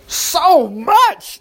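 high, delighted exclamation; Naomi's surprised joy at the grain; exclaimed. A narrator's reading. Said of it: "Naomi exclaimed".

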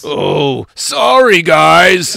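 loud goofy cartoon dog voice; Scooby-Doo voice. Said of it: "Scooby-Doo has been covered by [name]".

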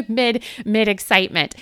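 loud, laughing through the words. Real, not acted. Host laughing about punching her microphone, loud. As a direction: burst out laughing at herself. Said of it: "I'm all excited".

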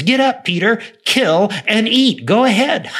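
high, loud voice from heaven; commanding heavenly voice. Acted. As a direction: call out the command with high, punched words. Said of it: "Peter hears a voice say the words".

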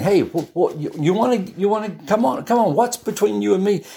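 high, stammering come-on challenge; evil spirit putting his dukes up at Jesus; dukes-up taunt. Acted. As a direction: square up and goad him. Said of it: "putting his dukes up".

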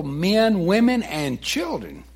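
ordinary talk, nothing marked

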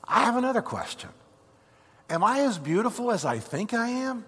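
high-pitched, curious questioning; wonder-struck thief, voice lifted high; eager former thief. Acted. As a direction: ask like an amazed newcomer.

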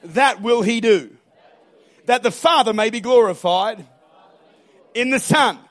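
loud, phrase by phrase, pauses between; emphatic preacher's call-and-response lead; leading a congregational repeat. Real, not acted. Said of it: "Say this after me".

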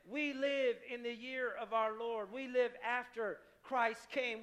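ordinary talk

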